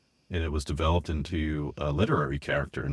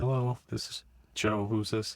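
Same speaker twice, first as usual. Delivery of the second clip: put-on higher childlike phone greeting; little kid voice; 4 semitones higher than usual. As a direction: answer the phone like a small kid. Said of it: "I like your little kid voice".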